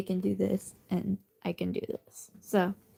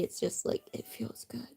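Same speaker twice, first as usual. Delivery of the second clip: whispering; 9 dB quieter than usual. Real, not acted. Whispering to sleepy pet.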